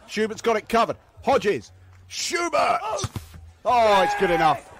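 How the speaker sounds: ordinary talk, nothing marked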